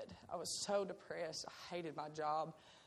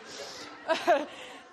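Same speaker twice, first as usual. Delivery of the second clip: a high breathy laugh of disbelief; incredulous laugh; 8 semitones higher, 8 dB louder than usual. Real, not acted. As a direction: burst out laughing at the absurdity. Laughing at the guy's condemnation.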